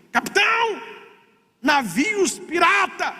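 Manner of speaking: very high, stretched alarm cry; retold warning shout. Crewman interrupts the captain's coffee with a warning, shouting. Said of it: "alguém gritou assim".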